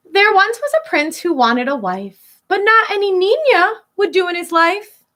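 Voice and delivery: put-on lilting read-aloud for kids; storybook reading. Teacher reading a rhyming picture book to children, lilting. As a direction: read the rhyme to a class.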